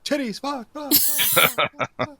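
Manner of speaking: high squeaky-pitched string of curses; mock swearing. Game master voicing the fall into the hole, high mock swearing. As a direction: let curses tumble out up high. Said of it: "it's like".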